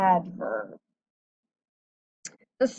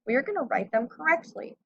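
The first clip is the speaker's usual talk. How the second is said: usual speaking voice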